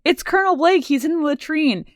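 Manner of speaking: high mock Radar yelp; high-pitched Radar retake; imitating Radar again. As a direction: squeak it like flustered Radar. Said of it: "Radar runs in and he goes".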